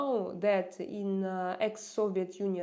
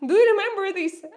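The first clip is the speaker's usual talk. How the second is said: excited; 11 semitones higher, 11 dB louder, faster than usual. Real, not acted. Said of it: "excited question to viewers".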